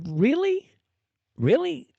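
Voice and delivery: high, loud disbelieving question; incredulous. Real, not acted. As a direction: challenge the claim in rising disbelief. Scoffing at a quoted post.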